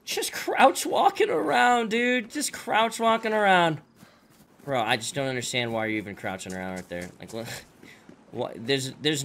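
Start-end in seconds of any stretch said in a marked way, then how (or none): 0.0-3.8 high, slow mocking repetition; mocking disbelief. Real, not acted. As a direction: drag it out, mocking the enemy. Mocking enemy's crouch walk.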